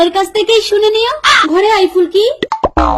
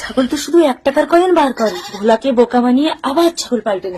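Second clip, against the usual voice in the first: low-pitched plotting aside; scheming aside; 7 semitones lower than usual. Acted. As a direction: lower your voice and plot to yourself. Aunt plots to swap goats.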